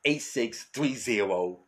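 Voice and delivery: high, loud hype ad voice; promo read. Acted. Host urging listeners to leave voicemails, high loud promo read. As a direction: pitch the voicemail like a radio promo. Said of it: "ad read for voicemail".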